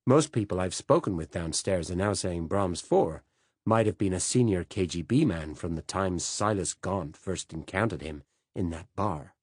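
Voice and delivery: usual speaking voice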